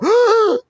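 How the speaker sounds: sharp high gasp, short and sudden; a quick startled intake of breath. Shown as a demonstration. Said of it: "I just run in and go".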